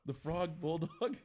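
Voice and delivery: slow, baffled echo; incredulous. Real, not acted. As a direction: repeat it in disbelief. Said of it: "echoes in disbelief".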